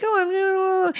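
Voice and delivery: high little mock gasp; a hammed-up startled voice; mock insecure gasp. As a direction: squeak it out like you're unsure of yourself. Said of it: "if you think".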